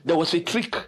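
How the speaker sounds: normal talking voice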